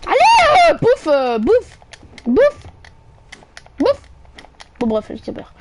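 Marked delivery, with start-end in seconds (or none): 0.1-4.0 high, loud eager yelling; excited shouting. Real, not acted. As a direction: yell at it to eat. Urging monster to eat, shouting.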